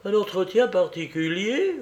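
high, rising scandalised question; alarmed. A narrator's reading. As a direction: repeat it back in mild dismay. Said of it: "répéta Olive avec effroi".

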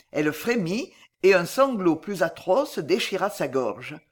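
plain reading voice

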